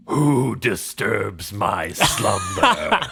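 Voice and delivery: slow booming undead moan; grinding stone statue. Acted. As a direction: groan it like a waking monster. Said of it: "you just hear this low moan".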